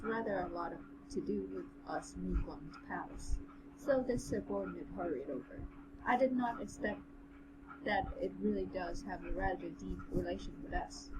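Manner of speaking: measured, even audiobook read; story narration. A narrator's reading. Narrator reading a fantasy novel to the co-host, story narration. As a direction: read the tale aloud with composure.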